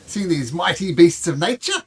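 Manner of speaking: high, loud, excitable Aussie showman; terrible Australian accent. Acted. Zookeeper showing off his beasts, exuberant fake Australian accent. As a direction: gush in a hammed-up Australian accent. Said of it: "My terrible Australian accent".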